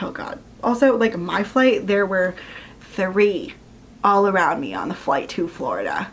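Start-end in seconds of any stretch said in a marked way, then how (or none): 0.0-0.4 breathy mock despair under the breath; whispered groan. To herself about the situation, a whispered groan. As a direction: whisper it like you've given up.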